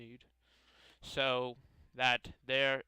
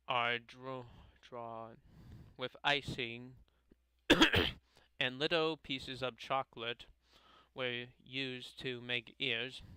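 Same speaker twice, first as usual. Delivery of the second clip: ordinary delivery, nothing marked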